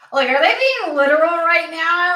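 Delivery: high, loud disbelieving complaint; exasperated outburst. Real, not acted. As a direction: throw up your hands at the cards. Frustrated reader scolding the cards.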